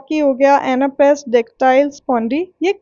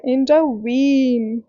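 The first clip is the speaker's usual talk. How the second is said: Intervene with वीन drawn out and stressed; 2 semitones lower, much slower than usual. Shown as a demonstration. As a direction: lean slowly on the वीन of intervene. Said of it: "वीन बोला तो मुझे ज़्यादा जोर देना पड़ा". (Intervene में वीन पर लंबा ज़ोर)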